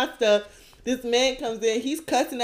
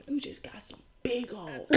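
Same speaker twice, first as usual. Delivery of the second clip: whisper; 17 dB quieter than usual. Retold or imitated. Man whispers compliment again.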